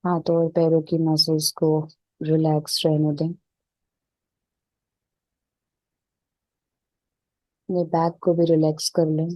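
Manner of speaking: even, unhurried meditation voice with little pitch movement; settled, level relaxation-session delivery; guided meditation. Real, not acted. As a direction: guide them calmly into stillness, leaving space between phrases.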